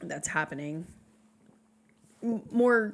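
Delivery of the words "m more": hiccups. Real, not acted. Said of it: "I have the hiccups".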